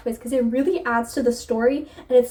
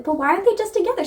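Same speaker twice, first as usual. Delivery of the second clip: higher, quick, exasperated reader asking; fan's question; 8 semitones higher, much faster than usual. Retold or imitated. Reader wondering about the couple, quick and exasperated. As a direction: toss the question out fast and pitched up.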